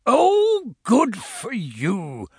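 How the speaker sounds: put-on rumbly old voice, swooping high then drawn out; slow, delighted approval with a big rising "oh"; Santa Claus. A narrator's reading. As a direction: draw it out as a jolly rumbling uncle. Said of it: "the voice rumbled in my ear".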